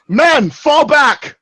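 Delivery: captain ordering his men back, loud and drawn out; commanding order. Acted. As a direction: bark the order to the troops. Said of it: "And I go".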